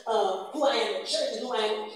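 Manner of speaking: ordinary talk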